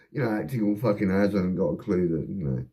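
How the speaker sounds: ordinary talk, nothing marked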